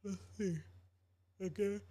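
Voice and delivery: words stretched by a stifled yawn. Real, not acted. Streamer reading the chat while tired, yawning. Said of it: "Again, my apologies".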